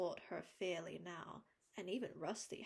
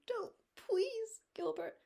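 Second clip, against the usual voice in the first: much higher, louder desperate plea; pleading cry; 14 semitones higher, 8 dB louder, slower than usual. A narrator's reading. Anne begging Gilbert not to speak, pleading cry. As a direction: beg with all she has. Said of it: "cried Anne pleadingly".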